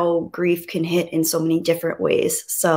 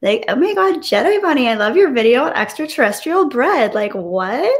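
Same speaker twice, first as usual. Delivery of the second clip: put-on high, swooping Valley Girl voice; over-the-top Valley Girl lilt; Valley Girl accent; 6 semitones higher than usual. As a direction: gush it like a Valley Girl, pitch leaping up and down. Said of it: "gotta do the Valley Girl accent".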